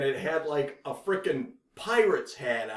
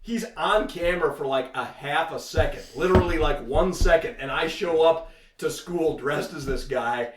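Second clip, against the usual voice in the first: usual speaking voice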